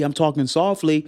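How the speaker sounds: ordinary talk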